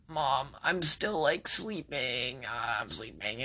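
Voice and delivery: slow, soft half-asleep voice; sleepy mumble. Retold or imitated. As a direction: mumble like you just woke up. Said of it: "And I'm like".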